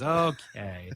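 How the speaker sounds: ordinary delivery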